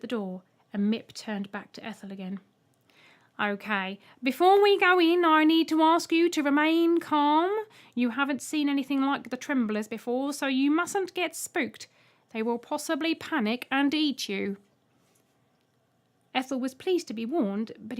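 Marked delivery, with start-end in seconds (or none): 3.4-14.6 lightly voiced robot; a touch of a chirpy robot guide; Mip. A narrator's reading. Robot guide warning the new passenger, lightly voiced.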